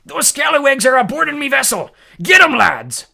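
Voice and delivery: high, loud pirate shouting orders. A narrator's reading. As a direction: bellow at the crew to get them.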